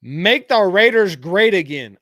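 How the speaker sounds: lightly voiced Trump slogan; Trump impression. Host answering chat with a Trump voice. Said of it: "why you just sound like Trump".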